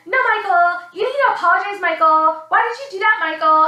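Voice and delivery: loud, high-pitched mock telling-off; imitated scolding mommy. Nicole pulls boyfriend into a corner, mock mommy scolding. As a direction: scold him like a fed-up mother. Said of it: "literally mommies him".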